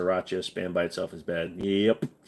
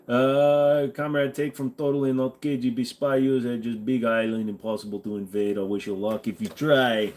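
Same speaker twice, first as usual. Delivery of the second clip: put-on Russian accent. Streamer reads a chat message in a playful Russian accent. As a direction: play a stern Russian comrade reading a chat message. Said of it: "my Russian accent is pretty good".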